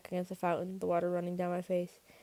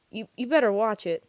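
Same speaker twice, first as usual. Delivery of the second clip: louder, higher stammered threat; warning; 4 semitones higher, 8 dB louder than usual. A narrator's reading. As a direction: warn them off firmly. Warning a rival gang.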